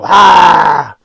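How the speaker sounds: loud mock scare yell; a sudden hammed-up scream; mock jump scare. Reviewer imitating a cheap jump scare, loud mock yell. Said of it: "so they go".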